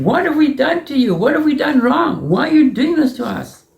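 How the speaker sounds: pleading protest. Acted. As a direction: throw the question at him, hurt and demanding. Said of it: "Yehuda jumps at him and says".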